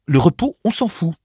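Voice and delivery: high, loud contemptuous retort; scornful outburst; dismissive young man. A narrator's reading. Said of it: "dit Hal entre ses lèvres imberbes".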